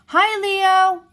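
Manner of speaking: high, loud call across the grass; shouting a greeting. A narrator's reading. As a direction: call out to Leo on his rock. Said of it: "they both shouted".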